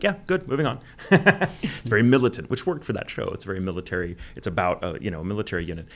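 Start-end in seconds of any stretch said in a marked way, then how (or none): 0.0-0.8 brusque director. Retold or imitated. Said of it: "He'll be like".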